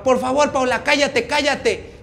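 high, loud acted plea; urgent pleading shout. Esteban runs up to silence Paola, urgent pleading shout. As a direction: beg loudly for silence.

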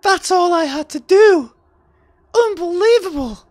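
very high, loud disbelief; real high-pitched outburst at an easy win; incredulous shout. Real, not acted. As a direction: yell in amazed disbelief. Amazed the bluff worked.

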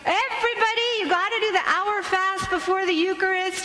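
high mock yell at the kids; a frantic parent hollering orders; hammed-up harried parent shouting; parent screaming. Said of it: "screaming, going".

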